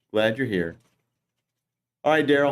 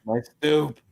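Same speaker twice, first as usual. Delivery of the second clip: grumpy stoop yeller; 4 semitones higher than usual. Acted. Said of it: "yell at some kids on the stoop".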